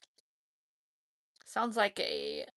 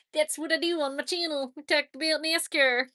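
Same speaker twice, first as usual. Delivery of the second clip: loud, raised, obnoxious nasal Southern twang; nasally Southern accent; 6 semitones higher, 7 dB louder than usual. Pretend. Streamer describing the channel, in a jokey nasally Southern accent. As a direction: put on a mock Southern accent through the nose. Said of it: "You gotta say it with that accent".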